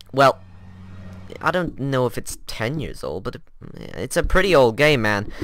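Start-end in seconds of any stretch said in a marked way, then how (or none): none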